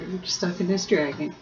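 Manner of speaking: ordinary talk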